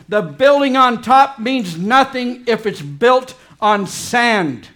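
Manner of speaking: loud, slow, high pulpit voice; full-force preaching. Real, not acted. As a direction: drive it home to the congregation.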